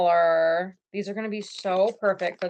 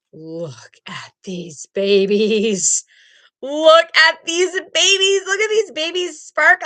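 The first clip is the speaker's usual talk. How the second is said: fond cooing over sparkly eyes; gushing; 6 semitones higher, 7 dB louder than usual. Real, not acted. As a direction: coo over them like babies.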